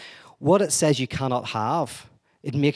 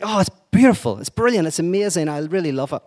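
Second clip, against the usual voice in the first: higher, louder, overdone enthusiasm; gushing fake praise; 5 semitones higher, 5 dB louder than usual. Boy lying to the girl about her awful painting, gushing. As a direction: oversell it like a lovestruck liar.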